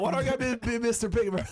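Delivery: high, whiny mock complaint; playful outrage at being Mister Pink; indignant protest. Real, not acted. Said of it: "No, I'm not".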